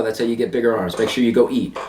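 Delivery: ordinary talk, nothing marked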